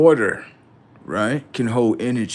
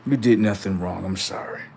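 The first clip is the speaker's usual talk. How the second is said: ordinary talk, nothing marked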